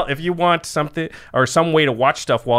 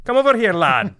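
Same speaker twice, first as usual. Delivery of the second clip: put-on Scottish accent; 5 semitones higher than usual. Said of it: "I won't".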